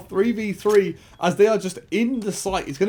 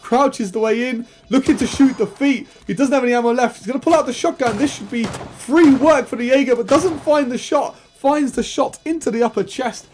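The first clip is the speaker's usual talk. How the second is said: rising, louder excited play-by-play; esports caster; 4 semitones higher, 6 dB louder than usual. Real, not acted. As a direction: call the clutch with mounting hype. Live play-by-play of a clutch.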